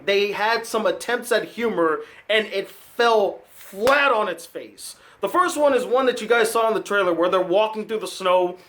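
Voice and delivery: usual speaking voice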